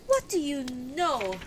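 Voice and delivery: high, startled demand; crying out. Acted. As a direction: cry out in shock. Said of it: "and I cried out".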